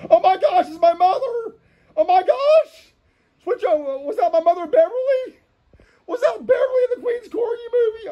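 very high, hammed-up shock; astonished squeal. Host spots his mother in the cartoon, high-pitched mock astonishment.

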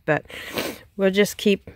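usual speaking voice

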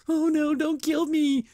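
high, slow, whimpering fake terror; the reviewer mocks Bo cowering before his enemy, slow and high-pitched; mock cowering plea. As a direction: beg like a coward, hammed up. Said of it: "Bo's, like, cowering".

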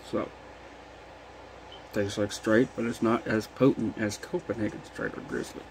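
talking around a lip of dip; words thick with a dip in; lip full of dip. Real, not acted. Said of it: "I already got a dip in".

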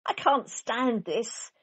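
slow, drawn-out complaint; exasperated. A narrator's reading. Mister Bear, sleepless, complaining slowly and wearily. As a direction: stretch it out, fed up. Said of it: "said Mister Bear".

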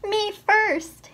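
high, loud "Me first!"; pushy piglet yelling to be first; piggy shout; cried out. A narrator's reading. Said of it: "he cried".

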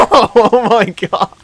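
high, loud, drawn-out laughing exclamation. Real, not acted. Gamer reacting to a mishap, laughing hard. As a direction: burst out laughing through the words.

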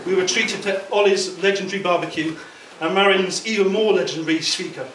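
normal talking voice

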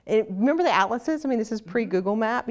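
normal talking voice

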